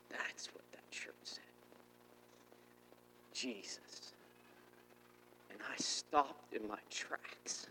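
very soft, near-whispered reveal; hushed awe. Real, not acted. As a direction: let it out in a reverent whisper. Awed reveal of the shirt's word.

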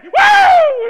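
piercingly high, loud woo; imitated whoop. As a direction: mimic her burst of cheer. Mimicking wife's late whoop.

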